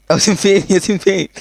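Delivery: half-panicked, half-laughing search. Real, not acted. (قلق مع ضحك)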